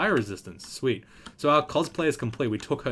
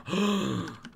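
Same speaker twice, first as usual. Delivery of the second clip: starstruck gasp; 8 semitones higher than usual. Pretend. Sees her idol.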